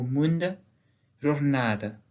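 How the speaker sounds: "mund" and "jornada" with barely sounded endings; "jornada" ending in a weak, audible schwa; reduced final vowel. Shown as a demonstration. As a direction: stress the vowel before the end, let the final one fade. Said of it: "prononcées un peu moins clairement".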